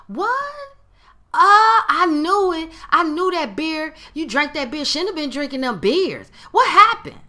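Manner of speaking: loud, high, gloating I-told-you-so; gleeful hater. Acted. Friend happy to hear something happened, gleeful. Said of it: "a joyful tone".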